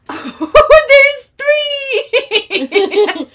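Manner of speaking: laughing. Real, not acted. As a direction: count through laughter. Laughing while counting.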